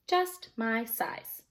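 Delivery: slow, soft satisfied remark; quietly smug, slowly; pleased. Acted. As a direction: savour the fit. Beckon pleased with boots.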